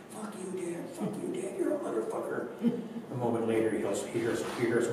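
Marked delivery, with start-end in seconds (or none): none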